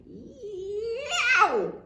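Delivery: very high loud pained yelp; wolf yelp. A narrator's reading. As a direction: scream as if scalded. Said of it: "with a scream".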